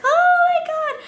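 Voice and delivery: high, loud imitated coworker squeal; excited gasp. Coworkers reacting to the donut box, high squeal. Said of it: "A lot of coworkers were like".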